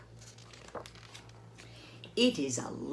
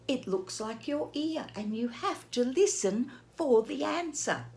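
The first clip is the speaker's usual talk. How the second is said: plain reading voice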